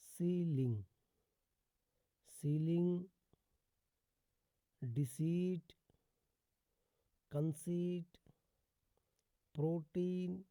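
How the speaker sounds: spaced-out demo words with a held "ee"; teacher's examples, long ई in प्रोटीन and the rest; long ee vowel. Shown as a demonstration. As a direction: say सीलिंग, डिसीट, कंसीट with the ई drawn long. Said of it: "बड़ी ई से करते हैं".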